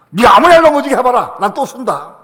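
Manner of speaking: fast, loud, high dare; defiant challenge. Shown as a demonstration. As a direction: challenge the devil. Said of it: "마귀한테 그래야 돼".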